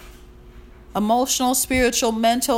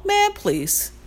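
dismissive; 11 semitones higher than usual. Real, not acted. Dismissing ungrateful people.

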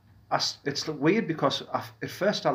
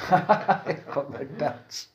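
laughing; much slower than usual. Real, not acted. Echoing the joke, laughing.

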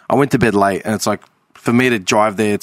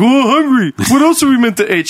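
higher and louder, whining; whiny kid; 12 semitones higher, 4 dB louder than usual. Retold or imitated. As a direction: complain like a hungry kid. Retold kids' complaint to mum.

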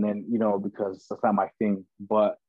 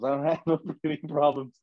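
laughing through it; 4 semitones higher than usual. Retold or imitated. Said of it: "Nah, I don't have no breathing problems".

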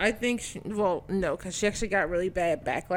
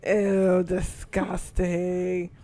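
slow, drawn-out disgust; grossed out; much slower than usual. Real, not acted. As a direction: stretch it out, repulsed. Grossed out by the image.

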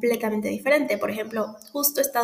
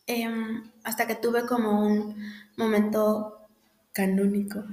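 ordinary talk

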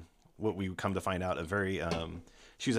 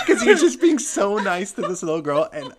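high, loud words through laughter; laughing hard; 13 semitones higher, 12 dB louder than usual. Real, not acted. Host laughing about the goat turning on the girl. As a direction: crack up while explaining.

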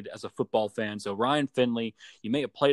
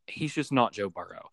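ordinary delivery

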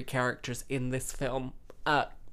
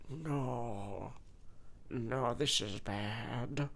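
hammed-up dismay; much slower than usual. Acts out cringing at uncomfortable scene.

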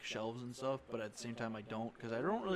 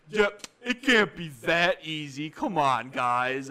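put-on loud drunk stranger; 3 semitones higher, 10 dB louder, slower than usual. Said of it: "And the dude's like".

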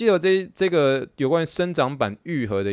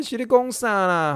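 high, slow disbelief; what am I saying; incredulous; 10 semitones higher, slower than usual. Retold or imitated. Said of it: "我，我的".

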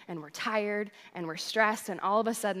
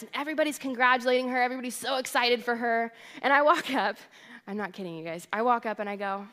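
ordinary talk, nothing marked

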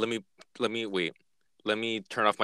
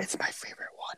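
whispering; 12 dB quieter than usual. Real, not acted. Whispered aside.

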